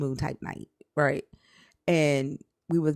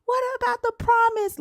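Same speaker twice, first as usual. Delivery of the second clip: high, loud anguished cry; screaming; 4 dB louder than usual. Retold or imitated. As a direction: shriek the question at the sky. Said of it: "Like, I'm screaming".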